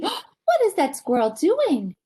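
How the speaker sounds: ordinary delivery, nothing marked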